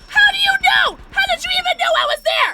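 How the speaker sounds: very high, loud shriek; real incredulous yelling; outraged screaming. Real, not acted. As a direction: scream the question in disbelief. Found and killed in game.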